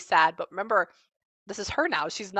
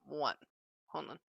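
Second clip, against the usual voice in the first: ordinary talk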